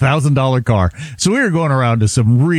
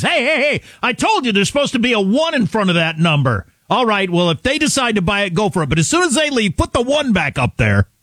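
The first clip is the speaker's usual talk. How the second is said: lightly acted boss, higher-pitched telling-off; car-lot boss; 7 semitones higher than usual. Boss correcting his salesman on the price, mildly hammed-up scolding. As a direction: pull the salesman up, half-playing the boss. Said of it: "his boss rides up and says".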